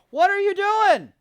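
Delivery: loud high slow exasperated yell; yelling at wife. Real, not acted. Vlogger yelling at his wife in the kitchen, annoyed. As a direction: call out across the kitchen.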